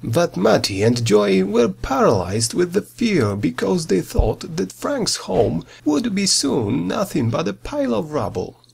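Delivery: ordinary delivery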